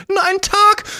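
fast, high, begging crone voice; pleading old woman. Acted. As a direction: rattle off pleas like a panicked old lady.

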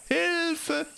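high, desperate call for help; cry for help. Acted. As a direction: shriek it in panic. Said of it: "rief Anansi".

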